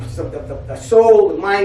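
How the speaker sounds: ordinary delivery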